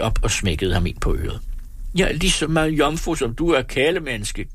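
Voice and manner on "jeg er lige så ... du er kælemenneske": higher, croaked retort; a mild croak, snapping at Janus. A narrator's reading. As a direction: croak it back at him. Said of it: "kvækkede hun".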